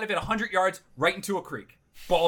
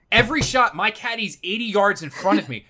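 loud, raised-pitch incredulous rant; exasperated complaint; 6 semitones higher, 6 dB louder than usual. Real, not acted. Golfer complaining his caddy walks far ahead, exasperated. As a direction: pile on the grievance loudly.

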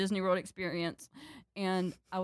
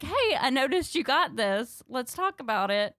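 mock calm mom; 6 semitones higher, 4 dB louder than usual.

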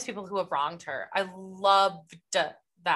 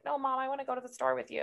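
quick, high, softer mock whine; whining teenager; 6 semitones higher, 6 dB quieter, faster than usual. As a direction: imitate a teen pestering mom. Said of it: "bunch of teenagers, like, whining".

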